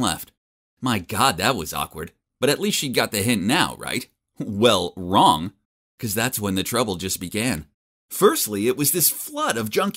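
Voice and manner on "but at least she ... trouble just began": ordinary delivery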